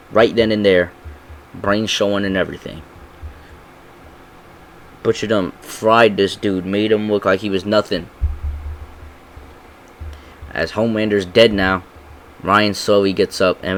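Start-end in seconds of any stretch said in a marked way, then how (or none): none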